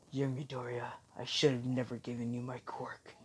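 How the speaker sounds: very soft, subdued disappointment; hushed, solemn reproach; grave regret. Acted. All Might voices regret to Midoriya.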